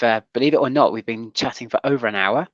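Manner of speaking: ordinary delivery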